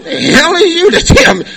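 mock indignant retort. Retorting to the newcomer.

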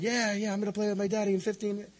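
put-on a boy thrilled he'll play with daddy soon; excited little boy. Boy screaming happily.

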